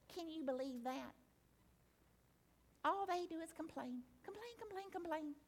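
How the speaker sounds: quietly imitating someone who grumbles about complainers; mock grumble. Imitating a grumbler about complainers, mild mock gripe. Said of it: "Instead of".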